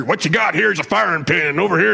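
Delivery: drill instructor. Retold or imitated. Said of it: "talking to you like a drill instructor".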